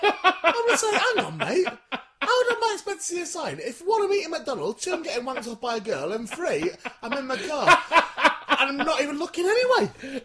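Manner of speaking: high, loud, giggling mock protest; laughing indignant. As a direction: protest while cracking up. Mock complaint letter, laughing.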